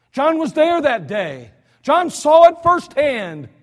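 slow, high, loud emphasis; emphatic preaching. Real, not acted. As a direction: drive it home slowly. Preacher building to a climax.

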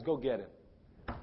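low, slow, soft order; hushed, weighty summons; quiet command. Acted. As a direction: say it gently and deliberately. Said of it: "he cries out and says".

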